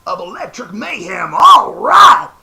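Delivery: high, loud funky bandleader rasp, ending in a big shout; Doctor Teeth. Pretend. Showing off his Muppet voices, a loud funky Doctor Teeth. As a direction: hype it up like a funky keyboard man. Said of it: "we got Doctor Teeth".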